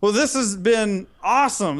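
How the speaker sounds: ordinary talk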